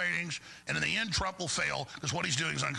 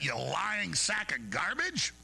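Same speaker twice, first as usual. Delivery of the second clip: ordinary talk, nothing marked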